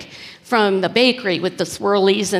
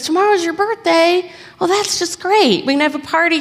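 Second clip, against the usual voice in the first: excited friend; 8 semitones higher than usual. Retold or imitated. Friend excited about the birthday.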